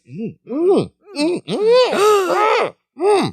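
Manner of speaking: high, playful mm-mm of enjoyment; happy eating hums. Retold or imitated. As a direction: hum high like you're savoring every bite. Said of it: "when they're both sitting there and just like".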